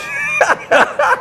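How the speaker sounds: big high laugh bursting out; loud burst of laughter. Real, not acted. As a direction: laugh out loud, caught off guard. Hat off, bald head joke.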